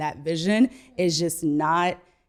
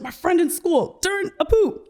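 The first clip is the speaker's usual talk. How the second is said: higher, lightly voiced small boy; little son's voice; 10 semitones higher than usual. Three-year-old son explaining to mom, light higher kid voice. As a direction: chirp it like a matter-of-fact toddler. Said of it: "He's like".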